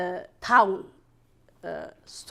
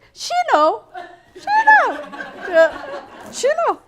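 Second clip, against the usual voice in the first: laughing insistence; 10 dB louder than usual. Retold or imitated.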